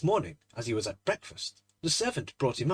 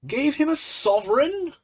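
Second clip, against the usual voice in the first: much higher and louder, a disbelieving shout; incredulous shout; 13 semitones higher, 9 dB louder than usual. Acted. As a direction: fling the words back at him, half laughing. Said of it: "shouted Trevor".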